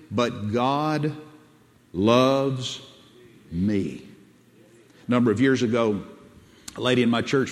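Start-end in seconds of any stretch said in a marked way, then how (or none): 0.1-4.1 each word set apart by long pauses; slow declaration. Shown as a demonstration. Preacher contrasting everyone with himself, slow personal declaration. Repeats the drilled declaration slowly.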